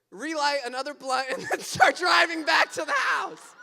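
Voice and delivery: loud, rising into laughter; laughing shout. Real, not acted. Storyteller recounting the battered men driving off, laughing shout. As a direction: crack up in disbelief as you tell it.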